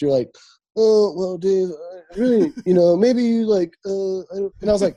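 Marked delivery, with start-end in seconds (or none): 0.8-4.2 imitating a friend's cagey, hesitant therapist voice, a bit louder; mock hesitant act. Teasing his friend by copying his stalling therapist act. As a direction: hem and haw like a careful professional dodging. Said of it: "I could tell you were, like, putting on a, uh, an act".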